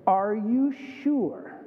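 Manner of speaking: slow, drawn-out parental challenge; probing question. Acted. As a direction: stretch each word out, testing the child. Parent questions the child again.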